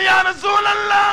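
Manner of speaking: high, held chanted call; impassioned cry. Acted. Ayesha calls to the Prophet, high chanted cry. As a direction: cry the address out and hold it. Preacher cries out Ayesha's address.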